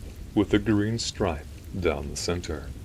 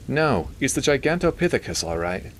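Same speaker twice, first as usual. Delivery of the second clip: nasally certainty, starting high; Milton; 8 semitones higher than usual. A narrator's reading. Doctor musing on the creature, nasally certain. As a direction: insist in a high would-be British voice, then settle. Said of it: "Milton mused".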